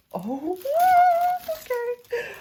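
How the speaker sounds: overwhelmed, squeak it out; gasp of awe. Real, not acted. Opening the practice hand box, a gasp and a squeak of awe.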